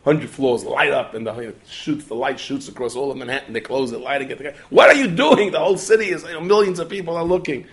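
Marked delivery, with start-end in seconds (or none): 4.7-7.7 put-on much higher and louder, scolding the kids; alarmed shout. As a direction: catch children playing with the switches.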